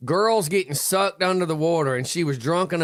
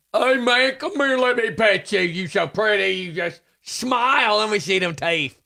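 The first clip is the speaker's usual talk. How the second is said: high-pitched, cooing fool; mock fool coaxing; 5 semitones higher than usual. Host acts a fool sweet-talking an alligator, mock coaxing. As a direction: sweet-talk it like a clueless idiot. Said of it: "there's no way my dumb ass is walking down".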